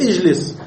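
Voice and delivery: اجلس begun with i, "ijlis"; kasra on the alif. Shown as a demonstration. Teacher giving the correct command, اجلس begun with i. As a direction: say اجلس as "ijlis", short i first. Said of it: "فالألف مكسورة".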